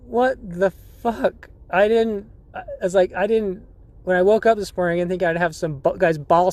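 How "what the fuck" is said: slow, stunned what the fuck; mock stunned gasp of disbelief; shocked disbelief. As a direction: drag it out in horrified disbelief. Shocked under naked old man.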